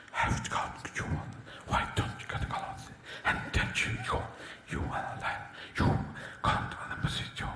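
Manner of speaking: breathy whisper right into the horse's ear. Retold or imitated. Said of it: "horse whisperers".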